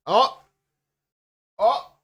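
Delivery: sudden high, loud oh; real surprised yelp; startled oh. Real, not acted. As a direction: caught off guard by the song. Reacting to the music starting.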